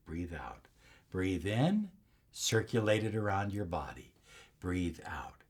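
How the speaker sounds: calm breathing guide trailing into breathy whispers; guided breathing. Shown as a demonstration. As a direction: guide the breath gently, fading down. Leads a breathing exercise.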